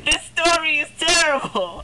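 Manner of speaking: a hammed-up disgusted friend; imitating disgusted friend. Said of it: "And she goes".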